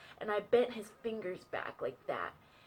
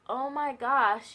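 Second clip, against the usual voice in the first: mock shocked gasp; 5 semitones higher, 8 dB louder, much slower than usual. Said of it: "I was like".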